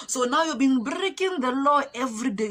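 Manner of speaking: mock-triumphant telling-off; imitated soldier's scolding. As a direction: pounce on the admission. Soldier pouncing on admission.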